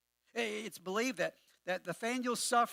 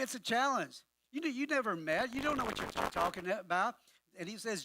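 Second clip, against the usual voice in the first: ordinary talk, nothing marked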